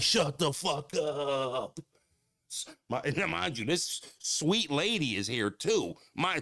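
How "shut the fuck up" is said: drawn-out disbelief. Retold or imitated.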